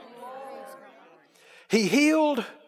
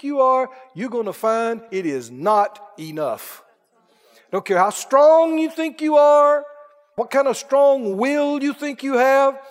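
loud rising sermon cadence, slowing at the end; fiery preaching; 4 semitones higher, 3 dB louder, slower than usual. Acted. Preacher exhorting his church, full-force preaching. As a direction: build to a shouted scripture.

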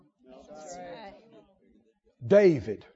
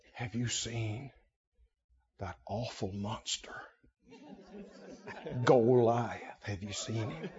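scared hush; 12 semitones lower, 13 dB quieter than usual. Retold or imitated. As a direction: murmur it so the giant won't hear. Frightened soldiers whisper about Goliath.

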